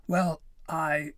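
flustered, halting and high; sputtering. A narrator's reading. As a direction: sputter for an excuse, caught out. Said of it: "Pim sputtered".

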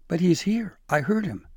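high, loud anxious protest; alarmed insistence. A narrator's reading. Frightened elf sure the tyrant is here, alarmed. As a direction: insist that danger is close.

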